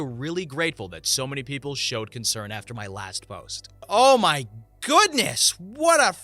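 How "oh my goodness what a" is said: slow, loud, higher amazed exclaim; stunned reaction. Real, not acted. Reader reacting to the wild story, slow, loud and higher. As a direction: let the shock of the story land.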